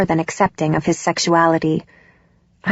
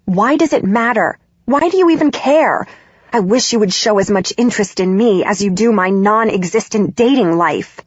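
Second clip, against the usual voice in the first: harsh and loud; 2 semitones higher than usual. Acted. Daughter losing it with her prying mother, harsh and loud. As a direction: lose your temper and shout it. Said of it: "My voice is harsh and loud".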